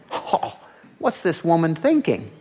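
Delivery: high-pitched indignant gasp and question; mock indignant. Preacher voicing onlookers judging the woman, indignant. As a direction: play an onlooker scandalized by her. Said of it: "some indignant".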